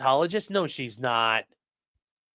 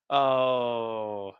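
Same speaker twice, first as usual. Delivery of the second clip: ordinary talk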